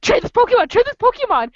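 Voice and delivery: high eager calling out; mock kids pestering to trade; lightly hammed trading shouts; excited fans.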